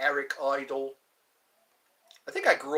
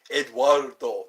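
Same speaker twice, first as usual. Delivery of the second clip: ordinary talk, nothing marked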